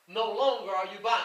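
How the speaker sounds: usual speaking voice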